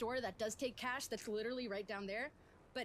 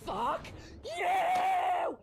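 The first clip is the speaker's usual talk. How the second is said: angry shout; 6 semitones higher, 12 dB louder than usual. Retold or imitated. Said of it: "she's like".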